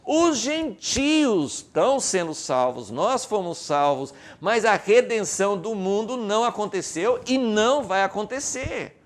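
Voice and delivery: loud, high-pitched preacher's delivery; forceful sermon voice; impassioned preaching. Real, not acted. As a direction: drive the point home to the congregation, loudly.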